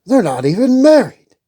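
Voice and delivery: a hint of a worried king; lightly voiced fretful king; King Stefan.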